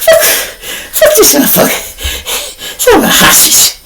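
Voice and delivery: acting out high, breathless gasps; imitated exhausted panting after wrestling; too winded to talk. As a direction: squeeze broken words out between mock gasps. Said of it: "I'm trying to talk, but I'm tired".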